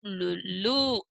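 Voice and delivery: slow spelled-out लु, लू; syllable by syllable. Shown as a demonstration. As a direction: sound out लु then लू slowly. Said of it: "तो इसे पढ़ेंगे उ".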